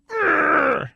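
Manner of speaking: hammed-up angry high growl shout; frustrated growl. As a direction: let out a mock furious grunt. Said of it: "He's angry".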